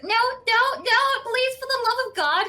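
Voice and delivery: high, loud, panicky begging; desperate pleading. Retold or imitated. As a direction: beg him off as if your life depends on it. Desperately refusing to be walked home.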